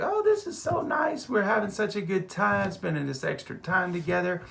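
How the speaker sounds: a high, sweet mock voice for the wife; husband imitating his happy wife; wife's voice. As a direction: gush like a delighted wife. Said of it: "my wife's like".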